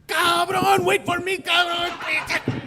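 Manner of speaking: very high, loud imitated shout; yelling after him. Little mugger chases departing moped, yelling after his partner. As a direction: holler desperately at someone leaving you behind. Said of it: "little guy's yelling after him".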